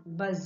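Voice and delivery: low buzzing bee. Shown as a demonstration. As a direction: hum it like a bee. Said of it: "making this sound".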